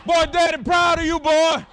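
high, loud cheering father; proud cheering. Retold or imitated. Father shouting praise to his son, proud cheer. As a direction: yell pride across the field. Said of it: "I said".

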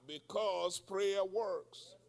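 slow, every word weighted; slow, weighty preacher emphasis; slow emphatic. Real, not acted. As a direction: lay down the lesson slowly.

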